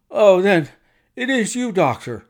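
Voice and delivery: high, loud burst of recognition; great relief. A narrator's reading. Frightened patient recognising his doctor, with great relief. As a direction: let relief rush out, pitch leaping up. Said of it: "with a great heave of relief".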